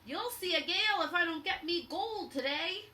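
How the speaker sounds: loud threatening pirate shout; captain shouting at the crew in the storm. Acted. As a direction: bellow the threat. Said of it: "Captain Bones shouted".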